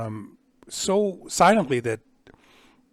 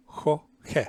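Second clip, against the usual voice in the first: usual speaking voice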